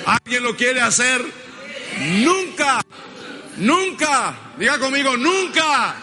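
high, full-force pulpit declaration; insistent preacher's cry; preaching call-and-response. Real, not acted. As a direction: drive each phrase out for the congregation to repeat. Preacher leading chanted declaration.

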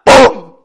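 sudden loud falling bang; imitated thud. A narrator's reading. Papá Noel collapsing in a faint, loud spoken thud. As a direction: punch out one explosive syllable.

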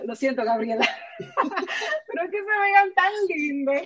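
high, giggling through the words; laughing. Real, not acted. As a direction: laugh while gushing. Gushing about a couple, laughing.

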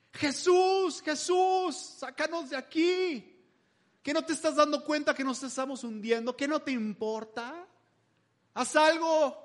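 high, desperate pleading cry; terrified crew begging Jesus to save them; hysterical disciples. Retold or imitated. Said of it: "los discípulos histéricos". (súplica histérica y aguda)

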